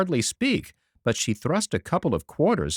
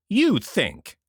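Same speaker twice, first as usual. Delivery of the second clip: scornful, higher question with a falling end; utmost contempt; 6 semitones higher than usual. A narrator's reading. Eliza sneering at Catherine's opinion, contemptuous. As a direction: sneer at the idea she can think at all. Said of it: "expressed the utmost contempt".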